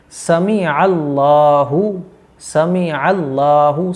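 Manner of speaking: very slow "समी अल्लाहु" with long "ला"; careful correct pronunciation. Shown as a demonstration. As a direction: separate "समी" and stretch "अल्लाहु". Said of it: "अच्छे तरीके से याद रखें". ("समी अल्लाहु" धीरे, खींचकर)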